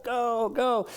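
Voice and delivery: high excited cheer from the sidelines; mom yelling. Retold or imitated. Mom cheers her son in a cross-country race, high excited yell. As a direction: call out like a mother urging her boy on. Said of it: "and his mom's yelling".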